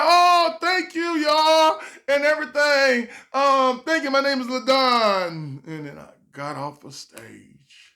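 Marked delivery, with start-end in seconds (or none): 0.0-5.6 high loud forced thank-you; forced cheer. Retold or imitated. Bombing comic thanking crowd, forced cheerful. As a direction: cover it with cheer.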